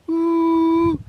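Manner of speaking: high steady squeal; a whole book crammed into one high blip; mock superfast speech. Savant showing off how fast he talks, mock superfast squeal. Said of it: "I just quoted to you the entire Encyclopedia Britannica".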